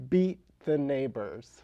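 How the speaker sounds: slow, punched reveal of the name; drawn-out deadpan reveal; font name reveal. Real, not acted. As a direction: land the punchline slowly. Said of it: "the name of the font is".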